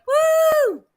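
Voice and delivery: very high, loud woo; a high, loud, jubilant woo!; excited whoop. Shown as a demonstration. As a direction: let out a sudden whoop of excitement. Shows jerk of excitement.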